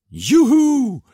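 very high, loud yelp of delight; boy whooping with joy; joyful whoop. A narrator's reading. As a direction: cheer in triumph. Delighted cry over spaghetti.

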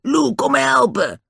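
high, strained call for help; panicked yell. A narrator's reading. Den under attack yells to Lou, panicked. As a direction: cry out, pitched right up. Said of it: "schreeuwde hij".

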